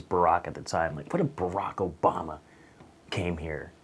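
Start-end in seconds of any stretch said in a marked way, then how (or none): none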